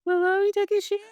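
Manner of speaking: very high put-on voice, hammed up; silly squeaky voice. Mocking the lone boy in the locker room, very high and silly. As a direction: squeak it out like a cartoon character. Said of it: "a singular person in a locker room being like".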